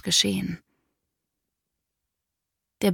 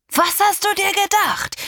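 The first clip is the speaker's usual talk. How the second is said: much higher, louder furious yell; father shouting higher; 14 semitones higher, 4 dB louder than usual. Acted. Father repeating the question in rising rage, yelling high. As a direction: escalate into a near-shriek. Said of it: "schrie er mich an".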